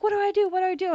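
frantic, high-pitched helpless questioning; imitated panic. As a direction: mimic a panicking driver pleading for instructions. Retold panicking driver after crash.

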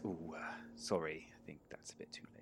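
soft unvoiced whisper; whispered apology. Gladius hushed over the gunfire, whispered apology. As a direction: breathe it out, sheepish and hushed.

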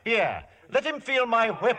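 high, loud, furious command; furious order. Acted. Saran orders the giant to whip Samson, furious shout.

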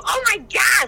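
high, loud sudden gasp; imitating a friend's sudden excited gasp; excited outburst. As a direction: blurt it out of nowhere. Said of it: "she'd go".

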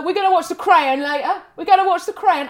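"The Crayon", Crown said broadly as two syllables, cray-on; mispronounced Crown. Retold or imitated. Quoting John's dad announcing the evening's telly, CRAYON for Crown. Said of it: "He was talking about The Crown".

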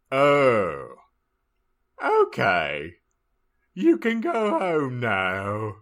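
loud, drawn-out professor voice; Slughorn impersonation. Acted. Professor dismissing a snooping student, loud slow impersonation. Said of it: "Slugborn impersonation".

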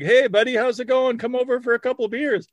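high, fast cheery buddy voice; mock friendly buddy. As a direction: play a chummy pal inviting him over. Friendly commenter on predator's post.

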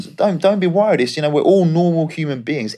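cheery Aussie agent checking in on his player; Australian agent impression. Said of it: "He goes".

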